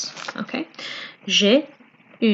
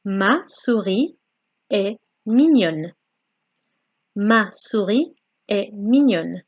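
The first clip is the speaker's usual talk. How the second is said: faster and faster. Shown as a demonstration. Teacher drilling a French phrase, building to fast. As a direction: drill it, quicker every time. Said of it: "the same thing with the mouse".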